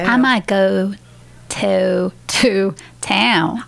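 "I might go to town" with a hesitant ah for I; learner tries Southern I. Real, not acted. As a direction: try flattening the I, unsure. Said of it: "switch your I's".

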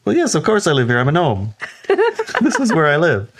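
high, laughing gnome voice; matter-of-fact gnome. Retold or imitated. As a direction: say it like the answer is obvious, voice up high. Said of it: "He says".

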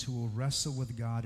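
ordinary talk

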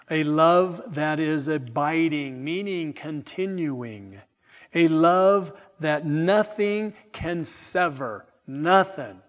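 slow, loud pulpit emphasis; emphatic preaching. Acted. Preacher proclaiming unbreakable love to his congregation, slow emphatic preaching. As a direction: stretch each phrase and land it firmly.

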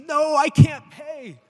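loud, high, drawn-out wail of a plea; hammed-up begging for mercy; desperate begging. Servant on his knees before the king, hammed-up pleading. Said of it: "gets down on his knees".

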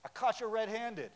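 loud triumphant Aha, then caught you red-handed; spring the catch on them loudly; mock accuser shouting gotcha; gotcha exclamation. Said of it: "It can kind of be like".

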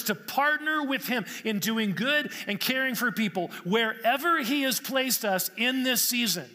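rising, emphatic preacher voice, some words slow; preaching. Acted. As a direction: preach it, pressing each list item. Preaching at full force.

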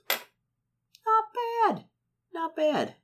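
very high, squeaky "not bad"; high-pitched approval. Player pleased with the gold total, squeaky high approval.

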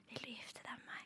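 barely audible, intimate whisper; whispered proposal. Acted. Boyfriend proposing in bed, whispered. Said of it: "og så spør han deg".

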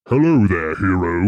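a hint of a menacing villain greeting; deep pitch shifted. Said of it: "they've pitched down his voice".

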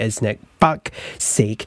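normal talking voice

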